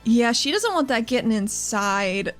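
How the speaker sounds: ordinary delivery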